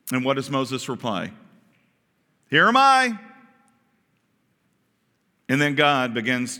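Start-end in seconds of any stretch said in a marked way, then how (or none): 2.5-3.2 high, loud, ready answer; calling out. Acted. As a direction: call back eagerly to the voice. Moses answers the call, contrasted.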